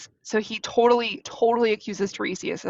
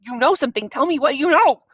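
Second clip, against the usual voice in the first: mock-frustrated demand, building higher and louder; increasingly upset; 6 semitones higher, 5 dB louder than usual. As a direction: press harder, climbing into an exasperated yell. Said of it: "continues to get more and more upset".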